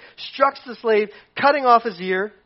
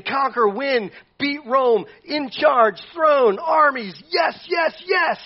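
rising, higher, louder, building to a shout; eager conquest; 7 semitones higher, 4 dB louder, much slower than usual. Retold or imitated. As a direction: hype up for war. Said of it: "Peter's thinking".

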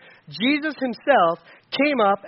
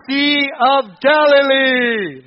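group call-out; 3 semitones higher, 5 dB louder, much slower than usual. Real, not acted. Class answers together.

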